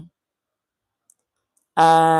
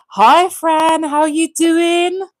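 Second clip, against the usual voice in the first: high, loud, slow greeting; shouting; 10 semitones higher, 6 dB louder, much slower than usual. Real, not acted. Greeting viewer Fran on the live, shouting happily. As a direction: call out to her like across a room. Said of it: "I don't know why I'm shouting".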